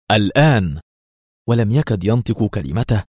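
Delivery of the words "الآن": a short narrated shout, only a little louder; restrained shout. A narrator's reading. Attacker signalling his gang to charge, restrained shout. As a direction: call out the order, held back. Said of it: "صارخا".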